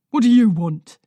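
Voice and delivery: put-on elderly husband confronting the visitor at the door; gruff old man. A narrator's reading.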